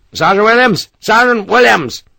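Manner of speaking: loud, high call across a building. Acted. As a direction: summon the sergeant urgently. Calling a sergeant across monastery.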